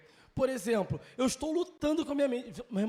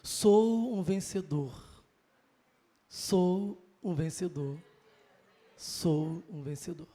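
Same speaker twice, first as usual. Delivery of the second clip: slow, deliberate declaration, dropping lower each time; a believer modelling how to speak the right words; slow affirmation; 6 semitones lower, much slower than usual. Shown as a demonstration. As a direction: repeat it slowly, like a lesson being learned. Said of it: "manda falar certo".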